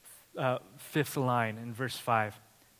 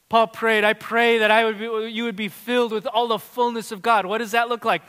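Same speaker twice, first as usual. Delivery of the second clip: loud, lifted preacher's voice with long pauses; full-force preaching; 7 semitones higher, 9 dB louder than usual. Acted. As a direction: preach it out over the congregation.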